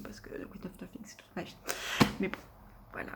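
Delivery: confiding whisper to viewers; whispered aside. Real, not acted. To viewers, about her favourite book: hushed whisper. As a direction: drop to a secret whisper of devotion.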